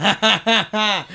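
bursting out laughing. Real, not acted. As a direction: laugh through the word. Laughing at own joke.

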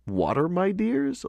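high-pitched amused surprise, without laughing; amused otter. A narrator's reading. Otter answering the puzzled little fishes, amused. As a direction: exclaim as if tickled by the question. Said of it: "laughed the otter".

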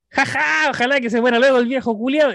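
mock commenter. Someone comments under a hospital photo, mock jeering. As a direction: imitate a jeering online commenter. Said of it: "abajo me dice".

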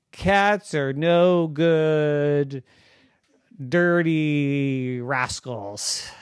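very slow, drawn-out mock insult; slow, relished storybook name-calling; teasing proclamation. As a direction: stretch every word of the playful jibe. Reads out dog's teasing book title.

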